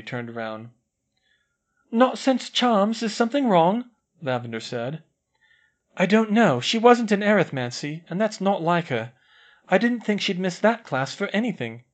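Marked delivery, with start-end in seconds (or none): 1.9-3.9 a schoolgirl asking her friends. A narrator's reading. Lavender voiced in story. 6.0-11.8 put-on higher, louder girlish worry; a schoolgirl worried about her friend. A narrator's reading.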